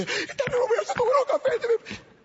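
put-on very high little boy voice; child's voice. A child telling the pastor in a high little voice. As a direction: squeak it out like a small child. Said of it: "Y él está allá".

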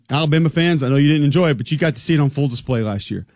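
ordinary talk

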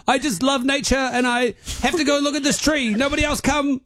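high, breezy fake excuse; lightly mock innocent; high fake-casual voice. Imagined excuse to go to tree.

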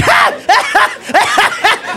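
high cackling laugh in bursts; mock uncontrollable cop laughter; dying of laughter. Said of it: "he's dying".

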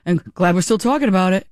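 a lightly mocked politician brushing it off; mock clueless politician. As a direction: play him as breezily unbothered. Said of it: "it's like".

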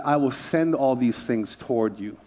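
ordinary talk, nothing marked

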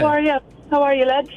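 put-on friendly Irish-accented greeting. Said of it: "bit of an accent".

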